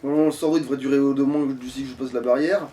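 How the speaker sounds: usual speaking voice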